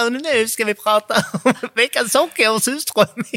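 lightly mimicked podcast intro; a playful take on his intro voice; imitating her co-host. Host mimics co-host's intro in a lightly mimicked voice. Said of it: "jag vill härma dig".

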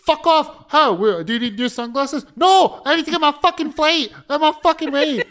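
high, loud exasperated shouting; mock yell. A late traveller yelling back at a pushy seller, loud and high. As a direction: bellow it like you're desperate to catch the flight.

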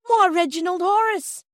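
high, loud anguished cry of a name; a mother crying out in terror; terrible cry. Acted. Barge woman crying out for her baby in terror. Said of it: "she cried in a terrible way".

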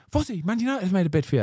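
high, fast, breathless announcement; rushing the news out, high and quick; excited teammate. Retold or imitated. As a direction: burst in with big news.

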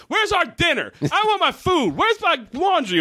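high, whiny demanding voice; mock a spoiled family barking for dinner; demanding brats. Bratty family demanding service.

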